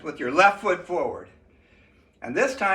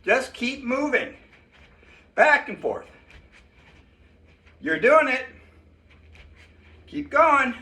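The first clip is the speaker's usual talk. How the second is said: coaching a workout; 6 semitones higher than usual. Acted. Leads a kids workout class.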